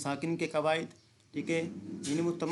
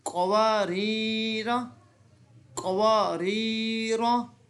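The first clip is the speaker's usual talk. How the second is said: very slow, higher, long held chant; Quranic recitation; 4 semitones higher, 4 dB louder, much slower than usual. Shown as a demonstration. Teacher reciting the verse for students. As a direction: hold each vowel long in recitation. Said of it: "इसको नहीं पढ़ेंगे।".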